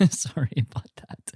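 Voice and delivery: soft whispered apology. Real, not acted. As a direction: whisper it off mic. Apology after choking fit.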